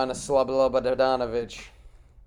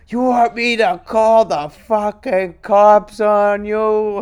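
loud, high, slow mock homeless-guy threat; mock threatening yell; 7 semitones higher, 10 dB louder, slower than usual. Homeless guy threatening to call cops, hammed-up loud yell. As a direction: bark it like a riled-up stranger. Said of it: "He turns around and goes".

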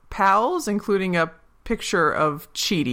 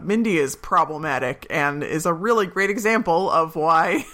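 ordinary delivery

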